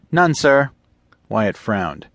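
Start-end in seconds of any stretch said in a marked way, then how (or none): 0.1-0.7 put-on higher, eager young officer voice; eager lieutenant. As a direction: report the find with keen urgency. Lieutenant answers captain.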